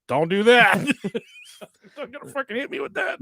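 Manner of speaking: laughing warning. Retold or imitated. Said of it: "I'm like".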